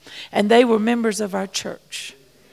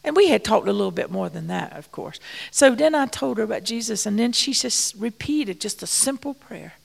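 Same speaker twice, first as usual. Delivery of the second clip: ordinary talk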